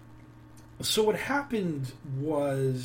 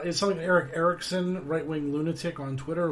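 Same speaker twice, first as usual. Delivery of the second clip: usual speaking voice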